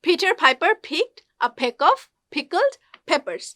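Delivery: slow "Peter Piper" with puffed Ps; careful, spaced-out model of "picked a peck"; P sound drill. Shown as a demonstration. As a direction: pop each P in "pickled peppers", word by word. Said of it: "পি সাউন্ডটা উচ্চারণ".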